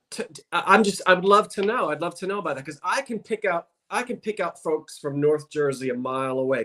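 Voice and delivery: normal talking voice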